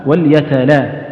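وليتلا said slowly with thin l sounds; both lams light. Shown as a demonstration. Quran teacher isolating the two lams to be lightened, light lams. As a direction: stop on a light, fronted "la" in وليتلا. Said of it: "يعني رقق".

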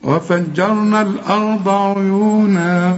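slow chanted tajweed recitation; Quran recitation. Acted. Teacher leading the class in reciting the verses, slow chanted tajweed. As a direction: chant each verse slowly, holding the long vowels.

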